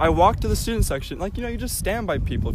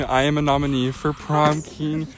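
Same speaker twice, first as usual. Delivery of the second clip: ordinary talk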